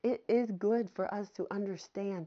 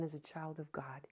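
hushed; 5 semitones lower, 11 dB quieter than usual. Real, not acted. Preacher whispering to the congregation, hushed. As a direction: drop to a near whisper.

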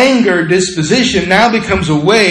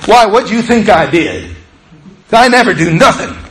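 louder and higher, defensive snap; angry retort; 6 semitones higher, 5 dB louder than usual. Acted. Angry person answering an innocent question, defensive and loud. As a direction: fire it back as if accused. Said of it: "But if you're angry".

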